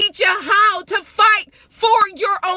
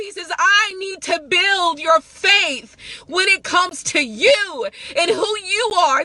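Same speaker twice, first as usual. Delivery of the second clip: ordinary talk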